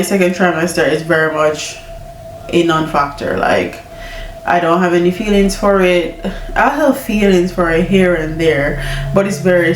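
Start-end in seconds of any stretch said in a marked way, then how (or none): none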